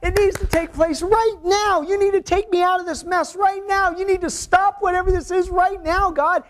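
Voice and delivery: hammed-up demand that God act now; impatient believer. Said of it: "we're sitting there going".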